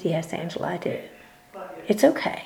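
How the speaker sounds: hushed, gentle answer; soft retold reassurance. God comforting the grieving speaker, softly reassuring.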